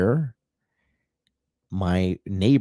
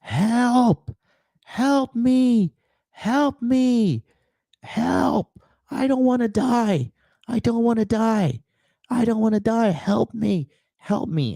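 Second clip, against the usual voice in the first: much higher, imitated old woman's cries for help; imitating a desperate scream; 13 semitones higher, slower than usual. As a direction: echo an elderly patient screaming for help, pitched way up. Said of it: "screaming at the top of her lungs".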